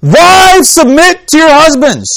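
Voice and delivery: loud, high, drawn-out "Wives, submit to your husbands"; "Wives, submit to your husbands" proclaimed high and loud; emphatic booming emphasis. Shown as a demonstration. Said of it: "what we tend to hear is".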